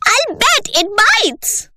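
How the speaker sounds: excited cry. Acted. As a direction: thrill at the danger. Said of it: "cried Lavender".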